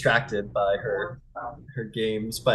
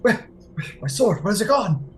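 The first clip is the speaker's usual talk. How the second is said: high, loud, gasping panic; panicked awakening; 14 semitones higher, 8 dB louder than usual. Acted. Freed man waking in a high loud panic. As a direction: wake up coughing and frantic. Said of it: "He goes".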